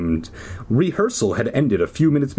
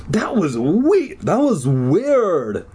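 slow, drawn-out higher spooked voice; Zeke exclaiming; 6 semitones higher, much slower than usual. Acted. Zeke reacts to the phantom, slow mildly spooked voice. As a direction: stretch it out, a little spooked. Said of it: "Zeke exclaimed".

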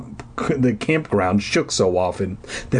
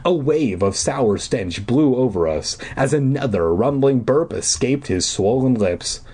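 plain reading voice

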